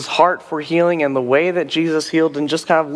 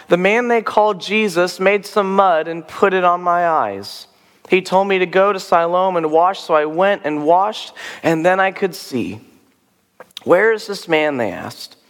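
measured read-aloud, higher on the quoted speech; scripture reading; 4 semitones higher than usual. A narrator's reading. Reads the Bible passage aloud.

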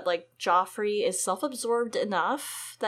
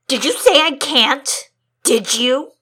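menacing anger; 3 semitones higher, 10 dB louder, slower than usual. Acted. Joffrey menaces Sansa.

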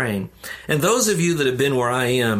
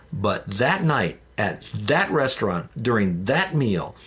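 slow, deliberate build-up; slowing for weight; 2 semitones lower, slower than usual. Real, not acted. Preacher slows down to tell the turning point, slow and deliberate. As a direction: drag out each phrase to make it land.

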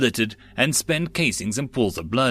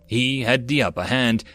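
usual speaking voice

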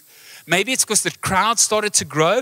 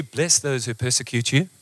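low, put-on voice of pat scriptural comfort; mock-solemn, dropped pitch; pious advice-giver; 8 semitones lower, 4 dB quieter than usual. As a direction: intone it like a well-meaning quoter. Voices someone offering scripture as comfort.

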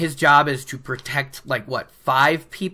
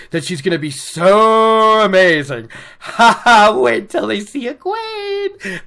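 hammed-up, louder, laughing boast; gleeful gloating; 5 semitones higher, 5 dB louder than usual. Imagining her gloat about bragging rights, gleeful crowing.

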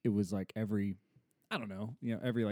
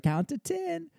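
imitated toddler; slower than usual. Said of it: "she can say that really well".

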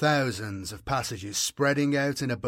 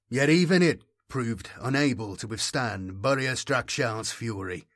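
plain reading voice

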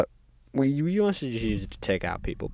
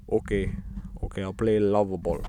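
put-on unsure, half-hearted Russian accent; Stalin; 2 semitones lower than usual. Stalin agrees to the game, in a rough Russian accent. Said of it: "I don't know Stalin's accent".

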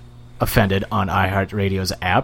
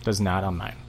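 normal talking voice

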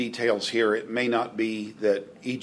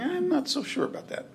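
high, soft, hesitant doubt; quietly unconvinced, in a raised pitch; doubtful listener; 5 dB quieter than usual. Acted. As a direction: voice a skeptical inner thought, pitched well up. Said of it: "you think".

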